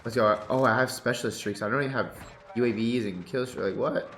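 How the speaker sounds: usual speaking voice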